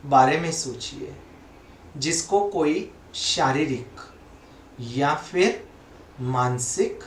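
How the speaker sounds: slow, measured meditation voice with long pauses; guided meditation. Acted. Teacher leading listeners to breathe in another's pain, slow guided meditation. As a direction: guide them phrase by phrase, leaving long silences.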